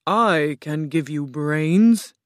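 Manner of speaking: lightly voiced stately enchantress announcing herself; grand Oz. A narrator's reading. Oz as green woman introduces herself, grandly slow. Oz offers brains.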